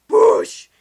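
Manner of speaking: loud explosive "boosh"; "boosh" blast sound. Retold or imitated. Narrating the birds fusing, loud explosive boosh. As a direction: burst it out like a magic blast. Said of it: "they go".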